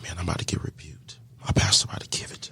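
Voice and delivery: hushed, quick mutter to himself; worried self-talk. Retold or imitated. Man dreads the pastor's rebuke, hushed self-talk. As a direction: drop to a near whisper, dreading it. Inner worry before being rebuked.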